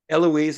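Eloise said as "EL-oo-eez"; helpful correction of the name Eloise; corrected pronunciation. Shown as a demonstration. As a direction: offer Eloise in three syllables, ending in "eez". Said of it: "is the pronunciation".

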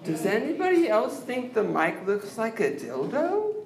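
usual speaking voice

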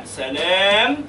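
usual speaking voice

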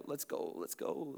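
quietly hurrying the others; hushed urging. Retold or imitated. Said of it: "disciples are going".

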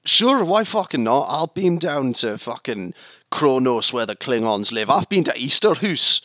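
slow, sweary Glaswegian bravado; cocky Scottish engineer, hammed up; Scotty. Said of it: "He's like, uh".

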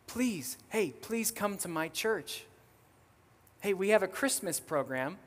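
retold childlike plea; pleading child. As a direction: beg eagerly like a persistent kid. Child begging her teacher in retold story.

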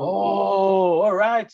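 high, drawn-out "oh" of understanding; mock realization. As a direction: imitate someone finally catching on. Retold boys suddenly understanding.

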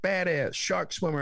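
sarcastic American. Retold or imitated. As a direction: sneer back their phrase. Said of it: "I love your American impression".

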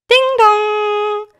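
sung high and loud like a bell; doorbell chime. A narrator's reading. Narrator voicing the doorbell that wakes Herr S, high chime. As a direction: ring it out like a doorbell.

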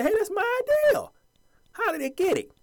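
high, put-on voice of an outraged inventor; high mock protest; indignant inventor. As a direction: squeal the complaint like a wronged inventor. Voicing the inventor's complaint.

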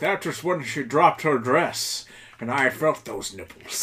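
slow, hammed-up Scottish Connery drawl; a lightly done Connery impression, slowed right down; Sean Connery voice. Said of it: "strictly in a Sean Connery voice".

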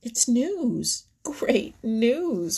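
high, loud announcement; excited cry. A narrator's reading. Little Joe Otter announcing news to the pool, crying out. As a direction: cry it out, bursting with it. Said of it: "cried Little Joe".

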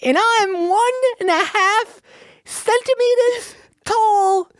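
tiny-person squeak, sky-high pitch; very high comic voice; silly voice. Pretend. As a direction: squeak it like someone shrunk to centimeters. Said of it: "A voice like this one".

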